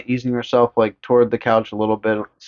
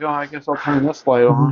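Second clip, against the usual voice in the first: put-on sleepy kid; 3 semitones higher than usual. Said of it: "He's like".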